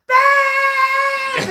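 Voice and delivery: loud high call across the house; hollering. Retold or imitated. Wife retelling how husband hollered for her from the house. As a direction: shout her name from the other room. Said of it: "he's hollering".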